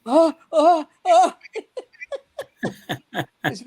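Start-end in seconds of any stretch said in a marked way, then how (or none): 0.1-1.3 higher, louder burning-mouth gasps; pained gasps. Retold or imitated. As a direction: gasp as if the mouth is on fire. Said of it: "he goes like this".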